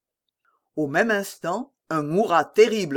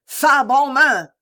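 loud, high, drawn-out order; shouted command; 9 semitones higher, 7 dB louder, slower than usual. A narrator's reading. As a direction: call it slowly across the battlefield. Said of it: "De Winter qui criait". (ordre crié, fort et traînant)

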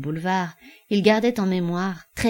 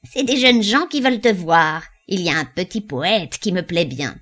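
put-on old woman voice; 6 dB louder than usual.

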